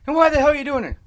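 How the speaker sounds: high-pitched, incredulous questioning; baffled disbelief. Retold or imitated. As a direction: imitate a coworker who can't believe it. Retold coworker's astonished question.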